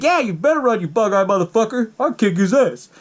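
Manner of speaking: high-pitched acted bluster; mock tough threat. Retells Zeke's empty threat.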